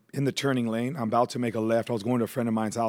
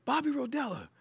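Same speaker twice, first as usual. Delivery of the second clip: high, faint call from far off; a name floating in from a distance; soft, high-pitched far-away calling of a name; distant call; 12 semitones higher, 7 dB quieter than usual. Retold or imitated. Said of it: "I heard it again".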